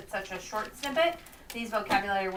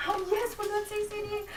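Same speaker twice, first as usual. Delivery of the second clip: high-pitched teacherly delight; excited cheer; 11 semitones higher, 3 dB quieter than usual. Real, not acted. Teacher cheering along with pupils.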